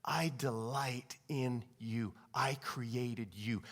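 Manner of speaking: low, hushed, very slow voice; soft, weighty divine reassurance; God speaking. Acted. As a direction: say it intimately, nearly whispering. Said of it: "God is saying".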